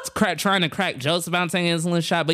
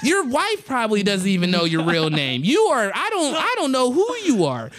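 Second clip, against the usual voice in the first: high-pitched accusatory bit; mock outraged roast; 7 semitones higher than usual. Comic roasting the host about his name, mock outrage. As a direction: hammer him with comic indignation, pitch raised. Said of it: "started going in on 'em".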